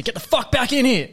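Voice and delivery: yelling at a stranger in a public bathroom; aggressive shout. Pretend. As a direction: bellow to scare a stranger off. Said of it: "and you say".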